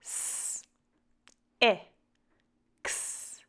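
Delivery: S, I, X as separate sounds, pauses between; sounding out. Shown as a demonstration. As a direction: say S, I, X as sounds, not letter names. Sounding out a word to blend.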